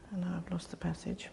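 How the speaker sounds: a soft whispered aside to herself; hushed aside while hunting for the page. Real, not acted. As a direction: drop to a whisper as if muttering to herself. Aside while searching her notes.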